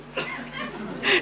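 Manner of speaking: short squealing laugh; high burst of laughter. Real, not acted. Preacher laughing at the story, high laugh.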